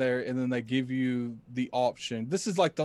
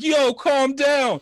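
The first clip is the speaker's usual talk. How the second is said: raised, drawn-out command, much higher and louder; loud confrontation; 12 semitones higher, 8 dB louder, much slower than usual. Retold or imitated. Player retells facing down armed lackeys, loud confrontation. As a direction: call it out slow and high to back them off.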